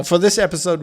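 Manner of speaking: ordinary talk, nothing marked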